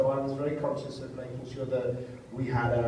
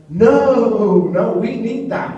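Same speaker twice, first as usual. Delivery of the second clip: insistent loud plea; 8 semitones higher, 12 dB louder, much slower than usual. Retold or imitated. Urging crew to laugh.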